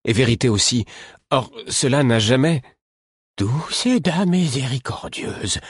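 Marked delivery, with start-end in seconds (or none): 3.4-5.6 slow, horrified cry to a lady; appalled old woman exclaiming; dismayed exclamation. A narrator's reading. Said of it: "s'exclama Caudron".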